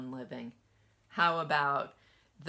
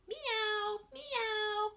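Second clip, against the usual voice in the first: very high meowing; cat meow. Acted. Cat sound read aloud.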